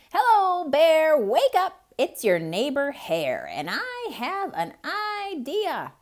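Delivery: high, loud, eager hare voice; a clever hare rousing lazy Bear; Hare calling. Acted. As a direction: call the sleeping neighbor awake, bright and pushy. Hare wakes the sleeping Bear.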